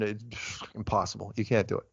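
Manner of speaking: ordinary talk, nothing marked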